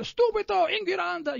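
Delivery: high, loud mock yell at a kid; mock shouting in Italian; retold yelling. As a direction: bark it out like an angry Italian parent. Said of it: "I would yell at me".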